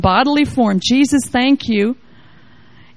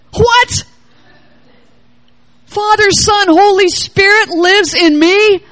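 a sudden, much higher yelp of surprise; astonished what; 6 semitones higher, 3 dB louder, slower than usual. Retold or imitated. Preacher voicing a listener struck by the news, astonished. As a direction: jolt up in disbelief.

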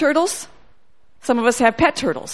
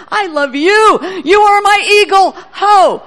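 slow, then louder and higher, swelling to a shout; God's joyful call; 6 semitones higher, 6 dB louder, slower than usual. Retold or imitated. God calls her his eagle, swelling to a joyful shout. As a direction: let God's love burst out, ending in a loud cry.

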